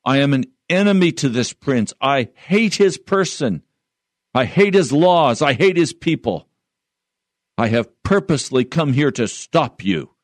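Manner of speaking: high-pitched raging villain, no real shriek; hideous rage. Acted. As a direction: read it as a fiend's hateful outburst. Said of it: "broke into a hideous rage, shrieking".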